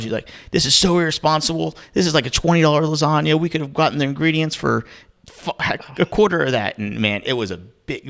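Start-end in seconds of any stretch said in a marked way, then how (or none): 0.5-6.8 higher, louder mock scolding; imitating angry wife. Retelling the wife scolding him at the store, higher and louder. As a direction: play the wife scolding over a pricey lasagna. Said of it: "She was so pissed off".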